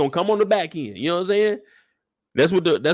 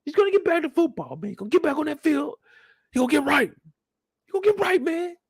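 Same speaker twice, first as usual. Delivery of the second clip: much higher, fast mock insistence; insistent father; 13 semitones higher, faster than usual. Father insisting his son will be fine, high fast. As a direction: talk himself into it.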